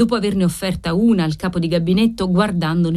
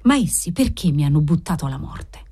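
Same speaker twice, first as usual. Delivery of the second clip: plain reading voice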